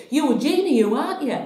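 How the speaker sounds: high, loud call from a car window; retold shout. Stranger shouting from her car at traffic lights, loud call. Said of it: "she shouted and she said".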